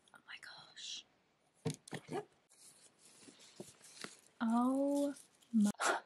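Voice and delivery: unvoiced, hushed disbelief; whisper, barely coping; overwhelmed whisper. Real, not acted.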